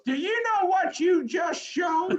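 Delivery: put-on high, outraged old lady voice; shrill mock of a shocked caller; caller's voice. Caller scolding the TV reporter, high outraged old woman. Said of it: "And the woman goes".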